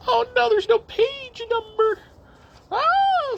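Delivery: cartoonish squeak of mock panic; squeaky dismay. Real, not acted. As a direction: play up the fuss over the lost page. Can't find the page.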